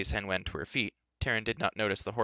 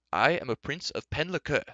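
higher-pitched haughty declaration; indignant cry; 5 semitones higher than usual. Acted. Prince asserting rank to the enchanter, indignant cry. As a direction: proclaim your rank, affronted. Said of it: "cried Ellidyr".

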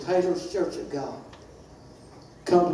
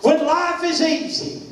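high, sung-out preacher's chant; preaching cadence; 9 semitones higher than usual. Acted. Preacher chanting promises to the congregation, high sing-song. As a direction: lift each promise like a hymn.

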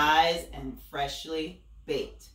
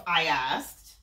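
ordinary delivery

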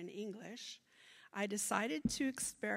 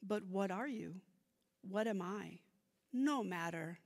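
measured, louder poem recital; poetry reading; 5 dB louder than usual. Acted. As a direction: read it like a poem onstage. Reads her bilingual poem aloud.

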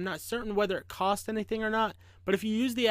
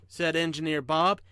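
ordinary delivery

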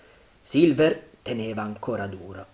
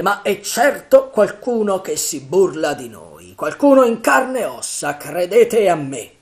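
loud, raised voice building higher; rally them with mounting force; rallying pirate chief; 6 semitones higher, 10 dB louder than usual. A narrator's reading. Silver reassuring frightened pirates, loud and insistent.

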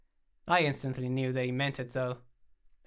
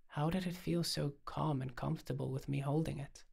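plain reading voice

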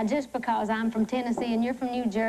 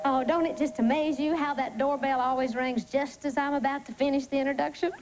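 ordinary talk

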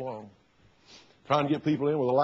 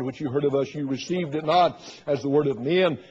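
normal talking voice

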